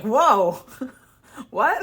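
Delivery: loud, high whoa then a laughing what; laughing shock. Retold or imitated. She reacts to the ex's cult warning with laughing disbelief. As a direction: burst out in disbelief.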